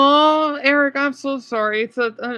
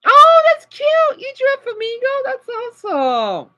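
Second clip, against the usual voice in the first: gushing praise; 6 dB louder than usual. Real, not acted. Streamer praising a viewer's flamingo drawing, high loud gushing.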